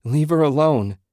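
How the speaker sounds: loud, high shout to leave her be; husband shouting at the shadows around his wife; desperate shout. A narrator's reading. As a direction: yell it, pleading and furious. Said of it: "he shouted weakly".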